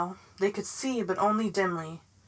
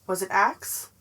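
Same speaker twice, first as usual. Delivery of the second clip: usual speaking voice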